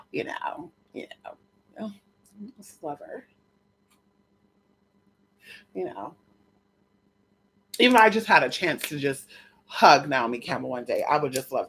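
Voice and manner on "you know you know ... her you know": dreamy hush. Real, not acted. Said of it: "trailing off into a dreamy hush".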